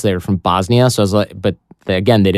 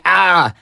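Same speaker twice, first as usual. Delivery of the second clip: retold yelp, pitched up; mock pained cry; 7 semitones higher than usual. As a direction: imitate crying out from a burn. Imitates his cry when burned.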